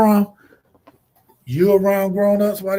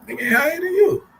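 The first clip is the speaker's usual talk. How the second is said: mock outrage; 10 semitones higher than usual. Real, not acted. Repeats punchline, pitch jumps.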